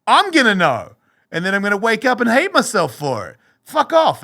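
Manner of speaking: high, loud, scornful retort; defiant outburst. Retold or imitated. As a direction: shout the temptation down. Retold rebuff to tempting voice.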